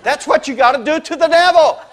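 high, loud astonished cry with a laugh; loud exclamation. Retold or imitated. Smith Wigglesworth crying out at the woman, loud and amazed. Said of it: "just out loud went".

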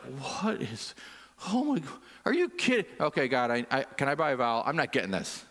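mock confusion, pitch jumping up then down; lightly voiced bewildered pleading; baffled prayer to God. Exasperated believer asking God for help, mock-baffled. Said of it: "I'm just like".

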